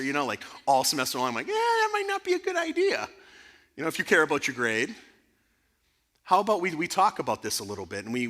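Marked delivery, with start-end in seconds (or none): 1.5-3.1 very high-pitched hedging reply; squeaky reluctance. Retold or imitated. Teacher talks student out of crushing the professor, high-pitched reluctance. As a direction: squeal out a doubtful answer, acted.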